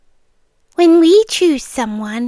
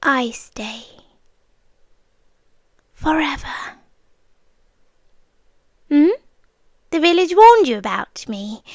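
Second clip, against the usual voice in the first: usual speaking voice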